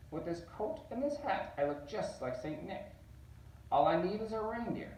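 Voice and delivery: high, gloating Grinch voice; gleeful Grinch. Acted. Grinch admiring his Santa disguise, high and gleeful. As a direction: crow it like a delighted schemer.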